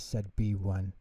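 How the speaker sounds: ordinary delivery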